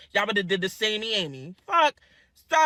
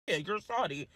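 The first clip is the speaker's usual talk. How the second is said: ordinary talk, nothing marked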